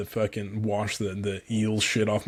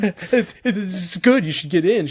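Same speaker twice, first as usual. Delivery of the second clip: mock fake cheer; 9 semitones higher, 6 dB louder than usual. Lying that water is fine.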